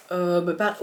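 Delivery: normal talking voice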